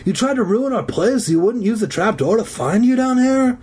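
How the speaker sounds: high-pitched accusing question; indignant boy confronting someone; demanding. Acted. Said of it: "Zeke demanded".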